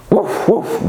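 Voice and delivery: high-pitched little woof; dog bark. Pretend. Teacher showing what dogs do, dog bark. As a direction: yap like a dog.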